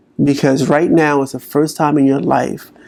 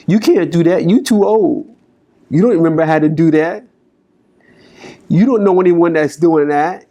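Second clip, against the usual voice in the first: higher, mocking put-down voice; nagging inner critic; 3 semitones higher than usual. Coach imitating the negative inner voice, mocking. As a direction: sneer at the dream like a doubter. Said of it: "Was it like".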